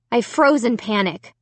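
plain reading voice

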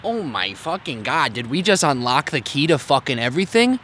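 ordinary talk

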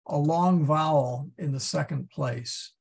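usual speaking voice

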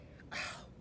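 acted weary exhale; storybook puff of effort; effortful sigh. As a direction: let out a breathy sigh of strain. Straining donkey in the story.

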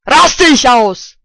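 loud slow furious shout; loud slow angry yell; angry outburst. Real, not acted. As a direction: let your rage burst out. Threatens to freak out.

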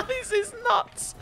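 high, laughing squeal of disbelief. Real, not acted. Gamer laughing at the chaos in the map.

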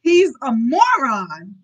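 loud, slow, hammed-up disbelief; appalled outburst. A narrator's reading. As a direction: cry it out, stretching the insult. Horrified duke denounces his son.

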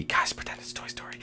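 hushed whisper to the audience. Real, not acted. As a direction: whisper so nobody hears. Hiding, whispering to viewers.

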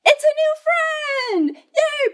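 excited storytime cheer; delighted. Acted. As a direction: squeal with happy surprise. Happy ending of picture book.

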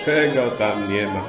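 ordinary delivery, nothing marked

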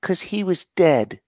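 put-on high, innocent little girl voice; little girl calmly saying the punchline. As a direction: deliver the dark punchline sweetly. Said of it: "And she says".

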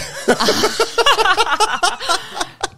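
long, very high laugh; real laughter. Real, not acted. Guest cracking up at the interruption, high laughter.

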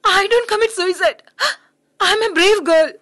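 high, loud, teary insistence; crying but brave; tearful defiance. Acted. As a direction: stand tall through tears.

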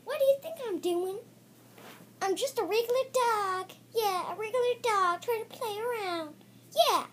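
high, quick playful dog voice; dog character voice. Pretend. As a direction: play a cheeky puppy.

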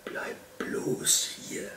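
slow, whispered warning; hushed, breath-only caution; murmured in the ear. A narrator's reading. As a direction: whisper it urgently into someone's ear. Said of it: "raunte der Fuchs dem Luchs ins Ohr".

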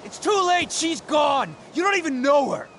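high-pitched, distraught yelling; anguished outburst. Acted. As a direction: cry out in angry grief. Acted quarrel about missing Rachel.

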